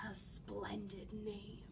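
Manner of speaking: sly wolf. Acted. Wolf purrs.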